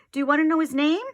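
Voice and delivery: bursting to share; eager. Acted. Eager child asking friend.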